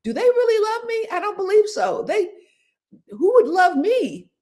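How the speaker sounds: high, pleading self-doubt, slowing at the end; insecure inner voice. Retold or imitated. Voicing her own doubts to the audience, high and pleading. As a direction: ask it like nobody could love you. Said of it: "you might say something like".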